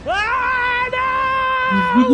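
high wailing protest; dismayed cry at the cliffhanger; anguished no. Retold or imitated. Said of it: "eu terminei olhando pra Agatha".

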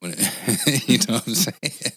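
drawn out through a laugh; amused, slow laughing check-in. Real, not acted. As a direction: chuckle through the words. Laughs after mocking shortcut idea.

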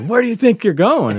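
high, loud demand to someone leaving; acted-out holler of confrontation; retold loud challenge. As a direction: challenge them loudly, as the armed barber. Barber challenges them with pistol.

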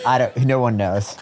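ordinary talk

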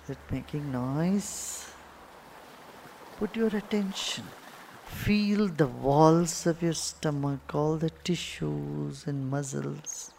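unhurried cues with long silences between; guided meditation. Acted. Guru guiding meditators' attention to the belly, guided meditation. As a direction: guide their attention inward, letting words stretch out.

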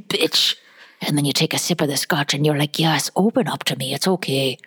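hushed mock Scottish accent; Scottish master distiller. Distiller teaching a tasting, intimate mock Scottish. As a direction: talk seductively to the whisky in Scottish. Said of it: "And he's like".

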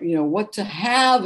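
normal talking voice

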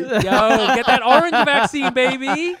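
loud, high hype-man shout; hyped pitch. Acted. Pitching the orange vaccine to Vietnamese folks, loud hype. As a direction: sell it like a street hustler.

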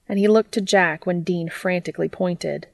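plain reading voice

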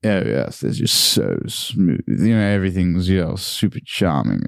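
put-on super charming old posh Englishman; elongated old-style posh, like running water; old posh boy. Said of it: "the old posh boys who sort of".

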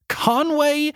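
drawn-out, high-pitched name reveal; slow, high comic announcement; savoured silly name. Real, not acted.

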